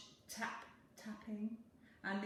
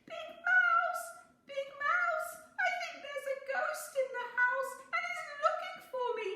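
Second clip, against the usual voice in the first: high, loud, fast frightened mouse voice; a scared little mouse crying for help; high panicky squeaky plea with teary laughs; 10 dB louder than usual. A narrator's reading. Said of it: "feeling very frightened, he cried".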